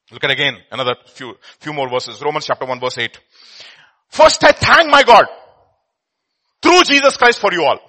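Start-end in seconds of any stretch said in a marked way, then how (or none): 4.1-7.8 loud, slow, higher proclamation; proclaiming scripture. Real, not acted. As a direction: declare it with joy.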